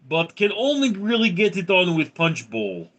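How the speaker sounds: high-pitched mock Russian accent. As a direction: play it up as a comic Russian. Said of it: "in a Russian accent".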